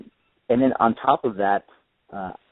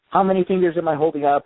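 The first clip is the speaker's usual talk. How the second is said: higher, louder sing-song schoolyard jeer; mocking schoolkid taunt; 8 semitones higher, 4 dB louder, faster than usual. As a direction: tease him like a bully kid. Kids taunting blind boy at school.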